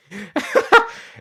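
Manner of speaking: sudden burst of high laughter; loud laugh. Real, not acted.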